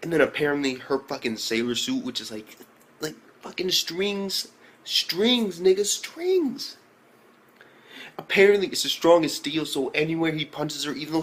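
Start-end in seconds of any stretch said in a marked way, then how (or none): 3.4-6.8 "strings" high and drawn out each time; incredulous emphasis. Real, not acted. Reviewer marveling at the skimpy suit, incredulous. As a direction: stretch "strings" slowly, higher with each repeat.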